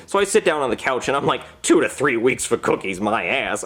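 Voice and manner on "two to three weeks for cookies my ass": low, muttered grumble with a mouthful; grumbling in anger. Retold or imitated. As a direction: gripe it under your breath while chewing. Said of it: "I say as I shove a cookie into my mouth in anger".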